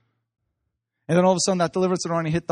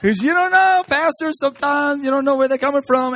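high, laughing aside to the pastor; 11 semitones higher, 4 dB louder than usual. Real, not acted. Speaker to the pastor, laughing high. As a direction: say it through laughter, pitched way up.

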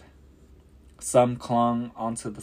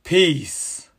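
high, loud commanding shout; thundering command; 9 semitones higher, 11 dB louder than usual. A narrator's reading. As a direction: thunder it over the storm. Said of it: "he thundered".

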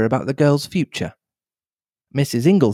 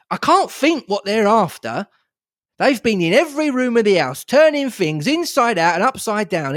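higher, exasperated complaint; angry outburst; 7 semitones higher than usual. Acted. John fumes to the family about the police search, angry and higher. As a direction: vent at the meddling detectives. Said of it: "unaccustomed frown of anger".

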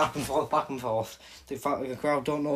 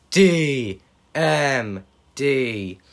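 very slow, louder letters; drawn out announcement; 3 semitones higher, 9 dB louder, much slower than usual. Retold or imitated. A fan announcing Britt Baker D M D like a ring announcer.

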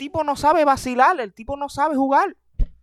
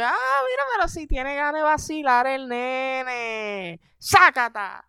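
fate mocking; 3 semitones higher, slower than usual. Fate punishing the boastful comedian, mocking and smug. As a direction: tease him, then slap him down. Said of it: "y el destino".

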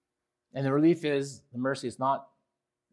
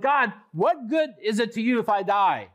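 raised, pointed rhetorical question; sarcastic challenge to God; 7 semitones higher, 4 dB louder than usual. Acted. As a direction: throw the question back at God. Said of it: "sarcastic, rhetorical questions he puts to God".

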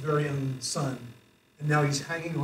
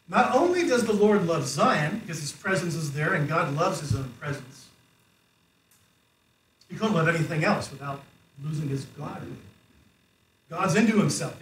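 ordinary talk

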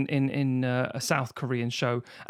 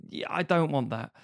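ordinary talk

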